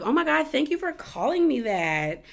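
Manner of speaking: high-pitched imitation of flattered delight; mock-delighted, pitched well up; gushing thanks. Acted gushing thanks for a compliment.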